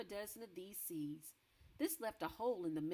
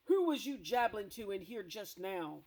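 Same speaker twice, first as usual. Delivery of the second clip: louder booming demand; booming giant; 7 dB louder than usual. Acted. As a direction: boom the question down. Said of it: "he boomed".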